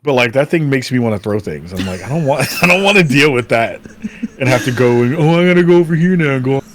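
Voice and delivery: ordinary talk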